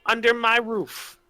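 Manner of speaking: high, slow warning to a freeloader; stern landlady. Acted. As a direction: lay down the house rules sternly. Landlady warns non-paying guest.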